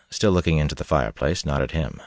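usual speaking voice

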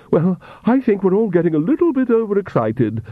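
high-pitched fluttery woman's voice; flustered Countess. Acted. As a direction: try desperately to calm everyone, pitch up. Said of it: "The Countess fanned herself desperately".

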